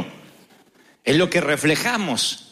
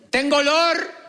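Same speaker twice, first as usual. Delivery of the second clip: mock confession; 9 semitones higher, slower than usual. Mock confession about one's smell.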